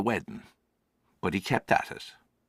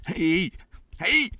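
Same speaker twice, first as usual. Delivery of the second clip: desperate angry yelling into emptiness; shouting; 10 semitones higher than usual. Acted. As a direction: bellow it out in anger at nobody. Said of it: "I started to shout".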